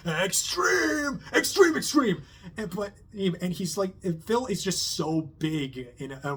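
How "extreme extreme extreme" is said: much higher, loud shouted extreme; Dusty's wild yell. Retold or imitated. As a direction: yell it like the over-the-top comic relief guy. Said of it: "he's just like".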